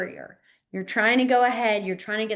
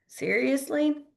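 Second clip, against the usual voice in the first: ordinary delivery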